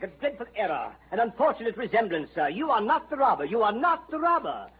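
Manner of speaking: high, hurried, apologetic; flustered apology. Acted. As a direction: blurt out the mistake in a fluster. Detective frantically admits his mistake.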